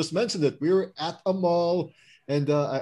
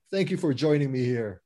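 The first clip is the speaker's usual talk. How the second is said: ordinary delivery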